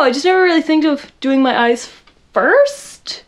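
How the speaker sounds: normal talking voice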